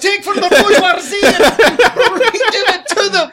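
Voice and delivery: high, loud laughing rallying cry; fiery revolutionary speech. Acted. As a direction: shout it like a revolutionary.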